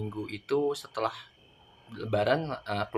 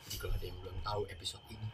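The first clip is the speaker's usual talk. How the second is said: hushed spooky whisper; 4 semitones lower, 17 dB quieter, faster than usual. Acted. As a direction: whisper it like a ghost story. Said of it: "matiin lampu nggak".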